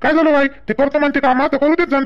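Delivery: calling to mother. Real, not acted. Son calls out to mother.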